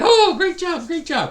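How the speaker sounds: high, loud thrilled cry of great job; excited gush. Retold or imitated. Worker thrilled at being offered a job, excited gush. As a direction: gush over the new job like a kid. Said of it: "And you're all excited".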